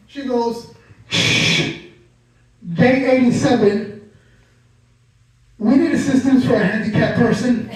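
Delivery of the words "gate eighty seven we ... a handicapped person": radio announcement. Retold or imitated. Airport worker announcing on her radio, loud mock page. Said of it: "makes an announcement on her radio that the whole airport could hear".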